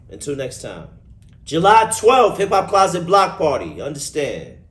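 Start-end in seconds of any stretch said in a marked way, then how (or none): 1.5-3.8 high, loud hype call; loud announcement. Real, not acted. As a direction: announce it like a promo. Announcing upcoming event loudly.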